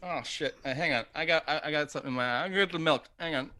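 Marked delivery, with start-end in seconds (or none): none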